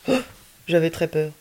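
ordinary delivery, nothing marked

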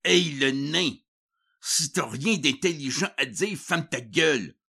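high rude bark at a coworker; rude boss. Acted. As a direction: snap it like a bully boss. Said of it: "Raymond le coupait".

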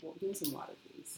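soft mimic of his low-key request; mock-casual hushed plea; imitating his request. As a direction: copy him under your breath, very soft. Mimics his quiet water request.

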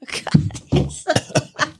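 wincing through a brain freeze; words squeezed out by brain freeze. Real, not acted. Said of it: "I got brain freeze".

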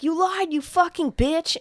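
high, mock furious accusation; retold angry yelling. Boyfriend accusing his crying girlfriend, mock furious. Retold screaming fight continues.

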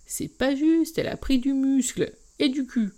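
put-on high, loud complaining kid voice; whiny protest. Little brother protesting to his sister, high whiny voice. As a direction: whine like a dragged-off kid brother.

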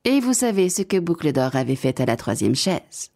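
plain reading voice

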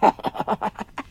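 fit of nervous laughter; jittery burst of laughing; nervous giggles. A narrator's reading. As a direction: giggle uncontrollably from nerves. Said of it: "fut pris d'un fou rire nerveux".

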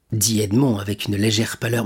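usual speaking voice

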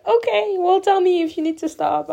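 high-pitched accommodating voice; imitated kindly dentist.

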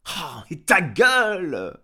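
loud, high, stretched-out yell; angry outburst. A narrator's reading. Farmer at window to howling dog, angry yell.

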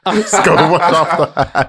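laughing through the words. Real, not acted. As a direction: say it while cracking up. Joking about washing off beer.

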